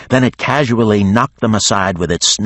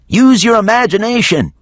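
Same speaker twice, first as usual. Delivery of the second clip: much higher, louder yell; exasperated shout; 5 dB louder than usual. A narrator's reading. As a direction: snap at him in frustration. Geneva shouting orders.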